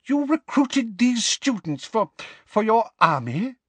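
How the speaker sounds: high-pitched, halting disbelief; incredulous. A narrator's reading. Minister questioning the headmaster about recruits, incredulous. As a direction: stumble on it, voice pitched right up.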